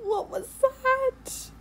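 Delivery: high, slow, whimpering fright; scared horror game streamer. Real, not acted. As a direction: whimper it, truly spooked.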